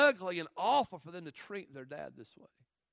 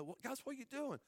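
higher, softer bewildered plea; bewildered protest; 6 semitones higher, 8 dB quieter than usual. Acted. Joseph asking his brothers as they sell him, bewildered plea. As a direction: act a brother who can't grasp what's happening. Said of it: "He's probably saying".

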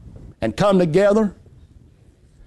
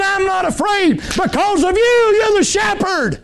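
sheep's bold cry; 9 semitones higher than usual. Acted. Preacher voicing the sheep to his church, high shout. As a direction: proclaim it like the fearless lamb.

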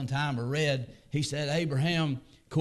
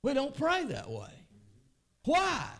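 very high, intense preacher's cry; preacher rebuking the congregation's prayer; fervent preaching; 4 dB louder than usual. Acted. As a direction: build to a loud cry. Preaching at full force.

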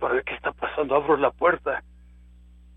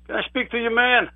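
neighbor calling; 9 semitones higher, 6 dB louder than usual. Retold or imitated.

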